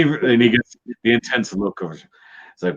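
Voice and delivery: usual speaking voice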